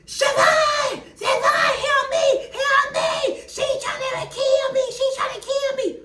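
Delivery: shrieking in terror over the phone; frantic screaming. Retold or imitated. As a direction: scream for help like you're being attacked. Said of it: "Mama Odie screaming".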